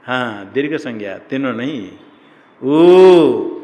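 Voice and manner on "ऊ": "ऊ" को ज़ोर से, बहुत लंबा खींचकर; drawn-out loud vowel. Shown as a demonstration. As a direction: belt out a long ऊ. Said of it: "इसकी ह्रस्व संज्ञा है ना नहीं?". (ज़ोर से खिंचा ऊ)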